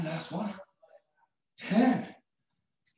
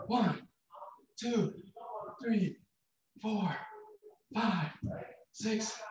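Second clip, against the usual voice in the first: counting reps; 5 semitones higher, much slower than usual. Acted.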